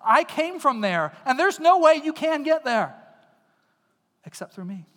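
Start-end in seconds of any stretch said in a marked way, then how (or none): 0.0-3.0 a touch louder, brushing it off; Jesus brushing off the table of good works; dismissive. Retold or imitated. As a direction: wave the idea away. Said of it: "He says".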